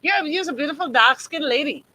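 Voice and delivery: sarcastic quote of beautiful dark-skinned lady; mock compliment. Shown as a demonstration. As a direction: deliver the backhanded compliment sarcastically. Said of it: "You just know how to tick me off".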